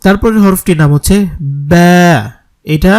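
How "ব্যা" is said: ব্যা said loud from the lips; Arabic ba. Shown as a demonstration. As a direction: press the wet part of both lips for ব্যা. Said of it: "দুই ঠোঁটের ভেজা অংশ থেকে". (দুই ঠোঁটের ভেজা অংশ থেকে ব্যা)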